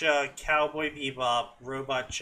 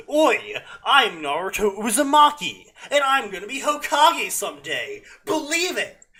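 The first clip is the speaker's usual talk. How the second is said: put-on higher, louder childish outburst; childish furious yell; 6 semitones higher, 5 dB louder than usual. A narrator's reading. Naruto boasting to the mocking Kyubi, childish fury. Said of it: "Naruto yelled in childish fury".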